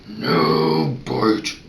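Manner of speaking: very low, controlled growl; calm growl. Shown as a demonstration. Vet calmly correcting a puppy who bites, low growl. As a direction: growl it low without yelling. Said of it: "calmly saying".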